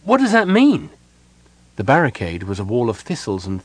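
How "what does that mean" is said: very high, loud, urgent question; shout over thunder. A narrator's reading. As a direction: shout for an explanation. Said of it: "Sylvan shouted above the drumming of the thunder".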